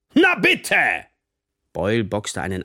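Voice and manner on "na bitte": loud, high crow of victory; triumphant outburst. A narrator's reading. Teacher hears pupil confess, triumphantly. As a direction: crow it, vindicated.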